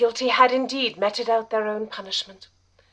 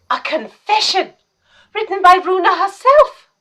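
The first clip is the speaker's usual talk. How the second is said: gasps, then blurts it out higher and louder; shocked gasp; 7 semitones higher, 4 dB louder than usual. Acted. Mary gasps in shock at Runa's written confession. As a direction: caught off guard by the find.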